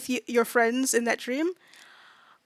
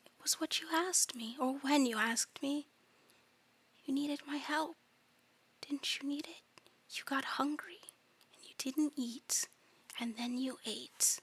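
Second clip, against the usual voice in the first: soft, whispery eldritch voice; hushed monster reminding someone of a bargain; eerie insistent creature; 3 semitones higher, 10 dB quieter than usual. Acted. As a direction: press the point gently and ominously.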